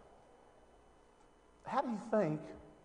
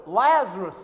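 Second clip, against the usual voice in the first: a loud ringing call, louder; 2 semitones higher, 13 dB louder than usual. Acted. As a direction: call the dead man out of the tomb. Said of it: "Jesus called in a loud voice".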